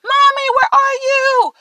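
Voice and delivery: high, frightened calls through the house; a small child searching room to room, calling out; child calling for mom. Retold or imitated. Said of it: "I'd be like".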